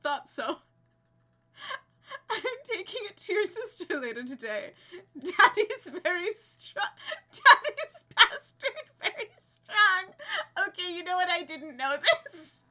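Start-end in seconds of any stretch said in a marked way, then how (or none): 5.2-10.7 high, slow words breaking into laughter; barely getting it out through giggles; laughing. Real, not acted. Streamer cracking up reading a funny phrase, laughing.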